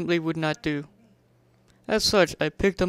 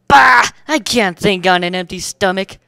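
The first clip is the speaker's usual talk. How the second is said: loud, exasperated huff; huffy outburst; 4 semitones higher, 14 dB louder than usual. A narrator's reading. Hero huffing at Twilight, loud and exasperated. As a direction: blurt it out, fed up and hungry. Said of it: "I said with a huff".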